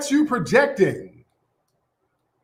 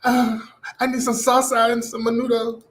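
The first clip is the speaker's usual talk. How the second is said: quieter, exaggerated Puerto Rican Spanish accent; mock Puerto Rican accent; 2 semitones higher, 4 dB quieter than usual. Mocking Puerto Ricans who put it on, fake accent. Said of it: "With all that accent".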